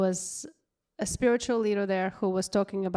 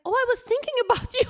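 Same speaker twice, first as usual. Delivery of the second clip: high fast mock girlfriend; 4 dB louder, much faster than usual. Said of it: "And she's like".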